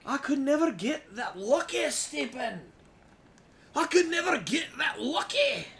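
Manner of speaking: shouted high and loud; mock shout. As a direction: bellow it in mock despair. Shouting at friend in jest.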